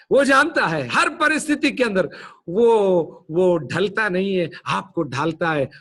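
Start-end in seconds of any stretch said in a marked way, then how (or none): 0.1-2.1 preached climax; rising proclamation. Acted. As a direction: proclaim it with growing force. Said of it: "repeated build".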